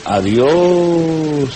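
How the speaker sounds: "adiós" read with a long stretched vowel; "adiós" with one vowel held long; drawn-out adiós. Shown as a demonstration. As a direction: stretch out "adiós" slowly and high.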